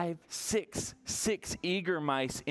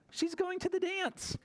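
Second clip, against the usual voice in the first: much higher, lilting little mouse line; high storybook voice; 13 semitones higher than usual. A narrator's reading. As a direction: lift it high and delighted. Story reading to children.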